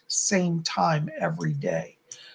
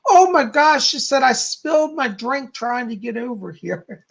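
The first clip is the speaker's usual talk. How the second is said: higher-pitched excited outburst, retold; excited spirit woman; 4 semitones higher than usual. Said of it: "she's so excited".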